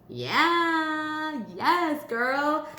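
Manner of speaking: high, drawn-out celebration; gleeful hype, stretching the yeah; delighted cheer. Real, not acted. Cheering the money card drawn.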